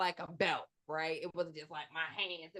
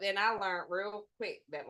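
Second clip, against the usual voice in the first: usual speaking voice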